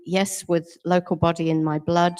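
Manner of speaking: ordinary delivery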